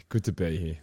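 Australian accent. Real, not acted. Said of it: "not us putting a voice on".

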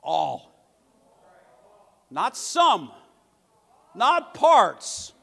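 loud, rising preacher's cry; emphatic pulpit shout, slow on the last line; preaching at full force. Acted. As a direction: hammer home that nothing is left out.

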